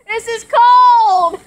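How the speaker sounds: slow loud high shriek. Real, not acted. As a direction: wail it out, half laughing. Shrieking under the hose.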